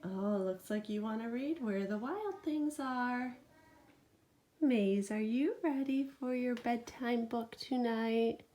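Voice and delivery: ordinary delivery, nothing marked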